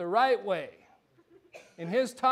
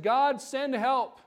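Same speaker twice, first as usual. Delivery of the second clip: slow, higher-pitched plea to God; drawn-out acted cry for help; pleading prayer; 5 semitones higher, 4 dB louder, much slower than usual. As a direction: call out slowly for help. Woman's plea when locked out.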